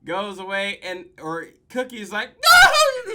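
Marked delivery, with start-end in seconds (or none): 2.3-3.1 high, loud anguished scream; full-throated yell of despair. Retold or imitated. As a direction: scream it like your world just ended. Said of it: "He just starts fucking screaming".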